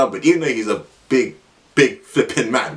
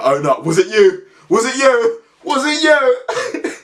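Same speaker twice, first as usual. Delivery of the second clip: building from a demand to a high slow cry; angry accusation; 10 semitones higher, slower than usual. Retold or imitated. Caretaker accusing the boys, rising to a high slow angry question. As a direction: keep pressing, higher each time.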